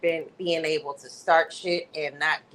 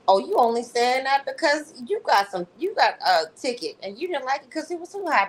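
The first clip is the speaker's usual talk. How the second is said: mock sassy Tiffany; 7 semitones higher than usual. Quoting Tiffany mocking Brandy.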